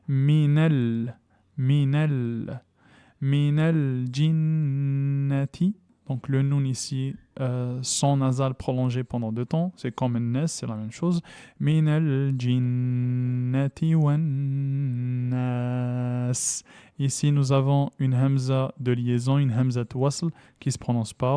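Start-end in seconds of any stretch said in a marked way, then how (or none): 0.1-5.8 minel with a sounded lem, then jinnati drawn slowly; slow recitation. Shown as a demonstration. Teacher drilling minel jinnati for students. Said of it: "on saute du nun au lem". 11.6-16.6 slow chanted recitation; Quranic recitation. Shown as a demonstration. Teacher reciting the verse slowly for students.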